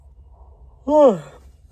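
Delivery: big exasperated sigh, loud and high; a heavy sigh at the crowd's choice. Real, not acted. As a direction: let out a loud despairing sigh. Sound after Pilate gives in.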